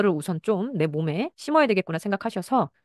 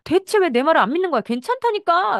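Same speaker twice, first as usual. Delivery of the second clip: much higher, louder, angry outburst; angry mother; 11 semitones higher, 6 dB louder than usual. Acted. Mother snapping at her child for not believing her, high and loud. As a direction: blow up at the kid, pitched way up. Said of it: "엄마가 화를 냅니다".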